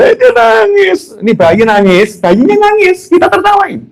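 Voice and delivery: put-on very high, gleeful exclaiming over a baby; high-pitched teasing glee; amused mock delight. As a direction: squeal it like a delighted onlooker. Acts adults amused by crying baby.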